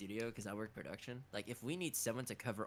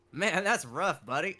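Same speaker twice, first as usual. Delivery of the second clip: loud, higher-pitched goofy aside; comic switch; 6 semitones higher, 10 dB louder, slower than usual. Retold or imitated. Imitating voice actor joking after a serious scene, loud and comic. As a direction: break into an exaggerated comic bit. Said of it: "Just, like, switching".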